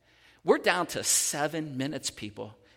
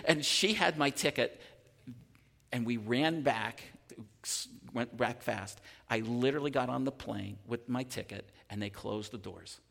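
ordinary talk

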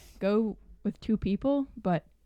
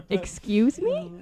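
drawn-out, higher, offended "excuse me"; indignant disbelief; 5 semitones higher, 3 dB louder, much slower than usual. Retold or imitated. Employee told she called a guest fat, indignant disbelief. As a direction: retell it as stretched-out mock shock. Retold shocked reply to accusation.

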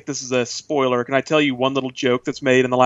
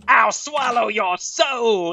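slow, higher, badly dubbed demon; bad movie dubbing; 8 semitones higher, much slower than usual. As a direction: drag it out like mismatched dubbing. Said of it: "really badly dubbed voice".

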